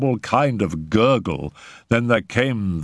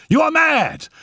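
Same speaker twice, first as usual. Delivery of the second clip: very high, shocked exclamation; alarmed outcry; 3 dB louder than usual. A narrator's reading. Raoul answers the raving mother, alarmed outcry. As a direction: burst out with pitch at the top.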